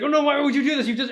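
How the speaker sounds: high, loud, indignant cry; loud protest. Acted. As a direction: wail it out as if wronged. Tahu protests the devolving.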